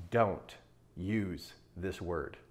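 slow, low and soft, word by word; grave advice. Real, not acted. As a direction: drop low and spell out the warning slowly. Solemn mentor's advice.

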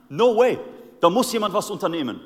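lauter, höherer Aufschrei der Empörung; empört. Retold or imitated. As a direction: auffahren vor Entrüstung. David empört über Goliaths Beleidigung. (David empört sich laut vor den Kriegern)

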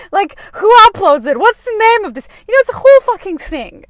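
high, loud overwhelmed outburst; worked up and loudly overwhelmed; exasperated rant. Retold or imitated. Retold overwhelmed rant about planning.

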